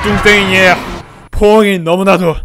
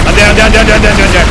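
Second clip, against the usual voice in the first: loud, frantic repeated no; desperate shouting; 2 semitones higher, 8 dB louder, faster than usual. Real, not acted. Gamer overwhelmed by monsters shouts in loud desperate panic. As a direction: shout in real desperation as they close in.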